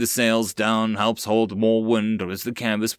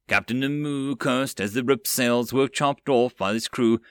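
ordinary delivery, nothing marked

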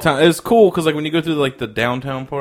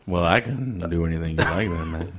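low, smooth radio seduction voice; sultry voice; 6 semitones lower, 6 dB quieter than usual. Pretend. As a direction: lay on a low sultry purr. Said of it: "much more sultry voices".

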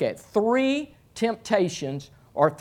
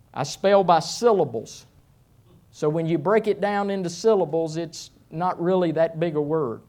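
ordinary delivery

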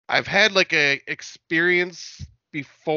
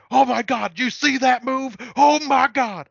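much higher, louder, squealing mock excitement; mocking ghost hunters; 11 semitones higher, 5 dB louder than usual. Mocking paranormal investigators spotting nothing, high squealing excitement. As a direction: ham up a hysterical ghost hunter. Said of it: "I make fun of those idiots".

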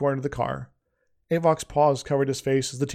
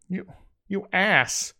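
slow, higher angry growl; 6 semitones higher, much slower than usual. A narrator's reading. Avok curses his friend, slow angry growl. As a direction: spit the insult out slowly. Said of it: "growled Avok".

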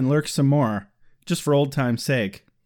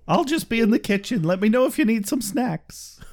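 lightly voiced higher-pitched mom; doting mom act; 7 semitones higher than usual. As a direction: sing out a hint of a helpful mom. Plays a mom offering snacks.

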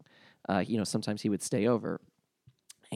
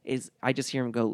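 ordinary talk

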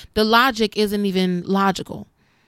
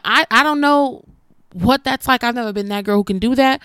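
normal talking voice

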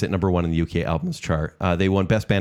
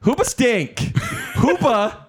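mock outraged shout; much slower than usual. Host shouting at Joel over his vote, hammed-up outrage. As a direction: yell the band name in fake fury.

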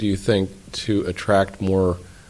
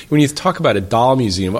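normal talking voice

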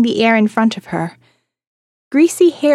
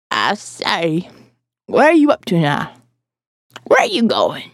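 put-on lightly croaked old lady; a touch of raspy old neighbour; croaking old woman; 5 semitones lower than usual. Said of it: "made the croaking more entertaining".

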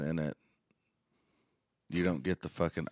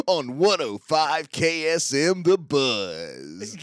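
radio DJ sign-off; 8 semitones higher, 6 dB louder, slower than usual. Pretend.

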